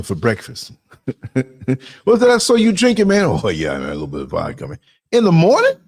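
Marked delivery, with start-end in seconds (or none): 2.0-3.2 high, louder questioning voice; mock surprised pal asking; quizzing a wino. Acted chat with a wino. 3.2-4.8 low, softer drawled reply; a wino owning up; lightly voiced wino caught drinking; sheepish wino. Wino answers in a voice. 5.1-5.7 high, louder disbelieving question; can't believe the morning drink; mock shock at a morning drink. Reacting to vodka at breakfast.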